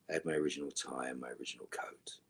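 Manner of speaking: conspiratorial whisper about the souvenir. Real, not acted. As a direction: whisper it like a little secret. Confiding secret about set items.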